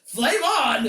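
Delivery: hammed-up catchphrase shout; hero's battle cry. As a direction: shout it like a superhero powering up. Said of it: "He says".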